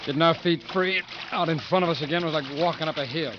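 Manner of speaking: steady retelling over music; story narration. A narrator's reading. Joe recalls the march in steady narration. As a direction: recount the march like a memory.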